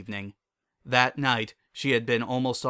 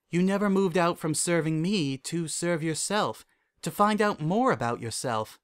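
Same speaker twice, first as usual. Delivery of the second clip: higher princess voice, confiding; composed royal mentor voice; Celestia, candid mentor; 5 semitones higher than usual. Acted. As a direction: confide in a former student, pitch lifted.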